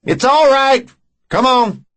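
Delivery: high, loud call from the doorway; shouting. A narrator's reading. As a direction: shout it across the lawn. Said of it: "came to the front door and shouted".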